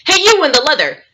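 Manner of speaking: high mock call to a stranger. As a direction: imitate a persistent man hailing someone. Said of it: "he calls out to me".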